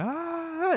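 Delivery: a short, high yelp of a scream; imitated scream. The bitten man still screaming, a high imitated yelp. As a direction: shriek out in pain once. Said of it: "he's just still screaming".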